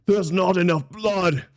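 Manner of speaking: a hint of a bloodthirsty brute; menacing taunt. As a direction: relish the gore. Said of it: "I jump in".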